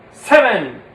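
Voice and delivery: SEVEN said loud and falling; punched seven. Shown as a demonstration. Puppeteer drilling the count, a loud falling Seven. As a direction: hit Seven hard, then drop. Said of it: "six, seven".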